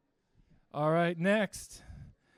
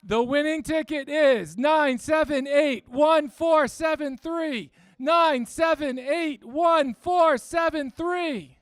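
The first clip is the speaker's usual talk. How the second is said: raffle caller; 5 semitones higher, 7 dB louder, much slower than usual. Acted. Announces raffle numbers.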